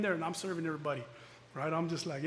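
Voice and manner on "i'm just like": ordinary talk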